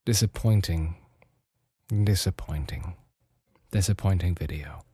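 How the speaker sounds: low, soft and let down; disappointed sigh. Real, not acted. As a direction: sigh the word out heavily. Sighing over a disappointing release.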